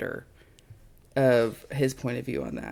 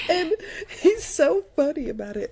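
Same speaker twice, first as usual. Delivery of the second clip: usual speaking voice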